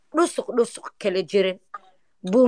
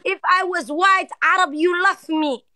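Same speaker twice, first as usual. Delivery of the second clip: mock jealous girlfriend; 7 semitones higher than usual. Prank caller accusing Mo of ignoring her, in a high mock-hurt voice. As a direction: play the wounded lover, pitched up and dragged out.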